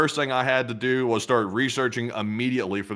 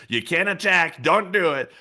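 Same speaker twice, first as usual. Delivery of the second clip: higher, lightly voiced scolding; a hint of a nagging official; mock US warning; 7 semitones higher than usual. US officials warning the UAE in a light mock voice. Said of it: "the United States is not telling the U A E".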